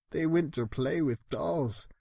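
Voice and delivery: ordinary delivery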